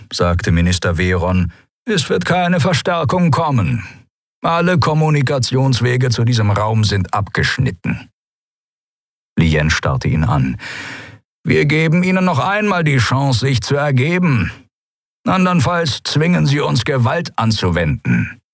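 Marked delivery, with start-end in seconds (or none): 1.9-8.1 hohe, amtliche Figurenstimme; förmlicher Minister bei Verhaftung. A narrator's reading. Veron droht als Figur. 11.4-18.4 hohe Amtsstimme, am Ende absinkend; förmlicher Minister, drohend. A narrator's reading. Veron stellt ein Ultimatum.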